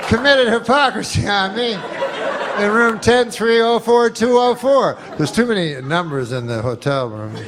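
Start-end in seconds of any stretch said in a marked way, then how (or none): 0.1-5.0 mock cry for help. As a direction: yelp it out high like a panicked victim. Girl screaming for help, mock.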